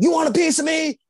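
loud, high-pitched taunt; mock challenging shout. Man challenging the friends in the car, mock shout. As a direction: imitate an aggressive man picking a fight. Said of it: "He's just screaming".

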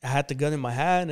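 ordinary talk

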